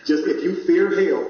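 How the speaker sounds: ordinary talk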